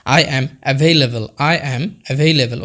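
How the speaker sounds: slow teaching demo. Shown as a demonstration. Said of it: "তখন আপনি বলতে পারেন".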